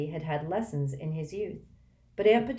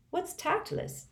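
bewildered; slower than usual. Acted. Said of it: "said Harry, bewildered".

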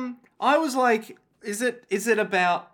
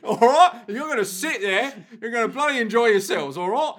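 bossy mock English geezer; put-on English accent, punchy "all right?"; hammed-up pan-English telling-off; 2 semitones higher than usual. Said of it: "talk like a couple of English people".

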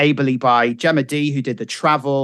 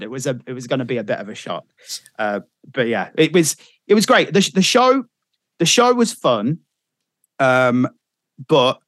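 ordinary talk

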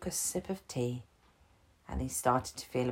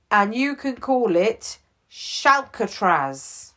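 loud, eager sidekick selling a big idea; eager Gibbons; 6 semitones higher, 10 dB louder than usual. Acted. Gibbons talks the chief into a prison island, loud and eager. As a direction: pitch it to the boss with bright, bubbling enthusiasm.

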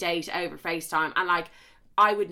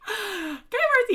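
self-hype; 3 dB louder, much slower than usual. Real, not acted. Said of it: "you need to relax".

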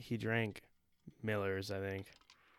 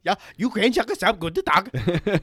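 loud, high cartoon German; mock German accent; 7 semitones higher, 6 dB louder than usual. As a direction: crank up the fake German to a shout.

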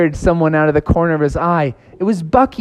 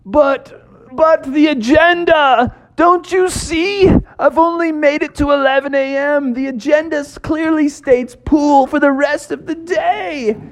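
pleading frog; 11 semitones higher than usual. Acted. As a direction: beg her over your agenda. Froggy pleads about agenda.

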